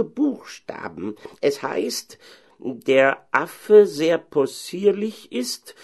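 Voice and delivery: slow, high-pitched pedantic cleric; learned head pastor. Acted. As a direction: pronounce it very slowly, voice pitched high. (gelehrter Oberpfarrer, gedehnt)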